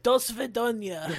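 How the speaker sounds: hammed-up fake Russian accent; cartoonish Russian accent, rolling it out; bad Russian accent. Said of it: "I probably do a better Russian accent".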